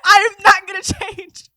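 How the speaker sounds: high, loud, slow declaration; defiant mock shout. Crier telling gawkers off, defiant mock shout. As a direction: draw out each word in playful defiance.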